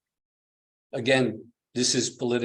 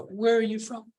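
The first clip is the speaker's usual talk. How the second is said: master's question; 10 semitones higher than usual. Acted. Master's question voiced again.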